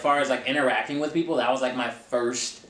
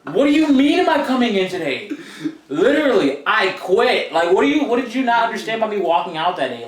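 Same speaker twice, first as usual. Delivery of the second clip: louder, higher, half-laughing indignation; outraged disbelief; 7 semitones higher, 7 dB louder than usual. Retold or imitated. Ex-employee to the boss who called after she quit, loud outraged disbelief. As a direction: let the disbelief boil over, loud and high.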